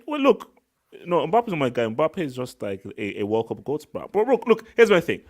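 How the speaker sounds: ordinary talk, nothing marked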